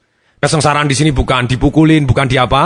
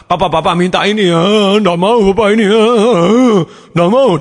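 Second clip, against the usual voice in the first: put-on higher whiny kid begging papa; whining child; 5 semitones higher than usual. Child begs papa to buy something, whining. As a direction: imitate a child's tearful whine. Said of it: "dia nangis, dia merengek".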